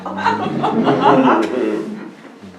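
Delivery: loud bird cackle; harsh cackling bird laugh; squawking cackle. Acted. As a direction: cackle like a mocking magpie. Magpie squawk after speech.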